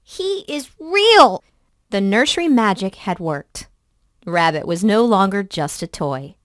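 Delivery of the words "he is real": high, slow, pleading insistence; a boy's high insistent plea; insistent child. Acted. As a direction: plead that he's real. Boy insisting to nanny.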